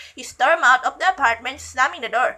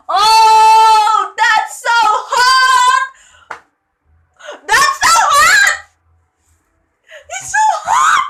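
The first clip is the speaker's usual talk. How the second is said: very high, loud drawn-out wail; fangirl squeal; 12 semitones higher, 13 dB louder, much slower than usual. Real, not acted. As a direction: squeal it slowly at full voice, swooning. Reader swoons over the character.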